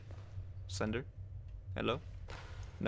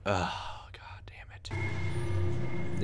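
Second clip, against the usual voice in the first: a breathless whispered curse; 3 semitones lower, 6 dB quieter, much slower than usual. Real, not acted. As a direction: hiss it under your breath. Muttered under breath.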